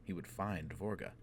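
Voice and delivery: usual speaking voice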